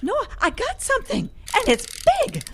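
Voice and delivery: high, loud thrilled cries; excited. Acted. Reeling in a huge fish, excited high cries. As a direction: shout it out, barely holding on.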